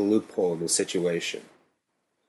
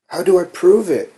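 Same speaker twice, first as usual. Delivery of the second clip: pleading; 9 semitones higher, 6 dB louder than usual. A narrator's reading. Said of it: "I pleaded".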